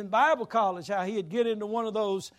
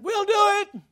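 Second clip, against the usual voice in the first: eager group reply; 12 semitones higher, 11 dB louder than usual. Retold or imitated. Students eagerly accept the challenge.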